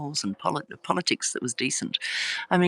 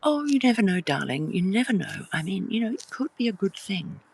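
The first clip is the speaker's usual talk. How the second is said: imitating mum; 6 semitones higher than usual. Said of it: "Mum would say".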